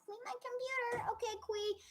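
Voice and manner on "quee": ordinary talk, nothing marked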